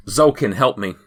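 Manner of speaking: loud, slightly contained cry for help; acted shout for help; shouted call for help. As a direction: call out urgently to an ally. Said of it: "she shouts".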